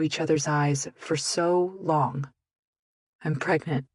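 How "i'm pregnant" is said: broken voice. A narrator's reading. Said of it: "I admitted in a broken voice".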